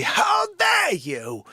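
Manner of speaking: loud, high, drawn-out mock outrage; imitated outraged catchphrase. Host mimicking Dick Fauci's catchphrase, loud mock outrage. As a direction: bellow it, stretching the words way out. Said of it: "he may say".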